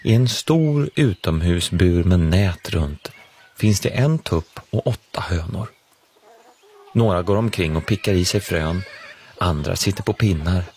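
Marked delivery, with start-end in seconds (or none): none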